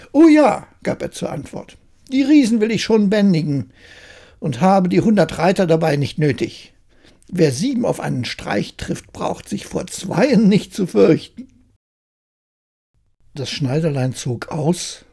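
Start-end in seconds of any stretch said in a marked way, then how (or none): 0.1-0.7 a high, loud, eager burst; eager yes. Acted. Tailor eagerly accepts challenge. 2.1-11.5 a lightly voiced cocky little tailor, bragging; boastful tailor.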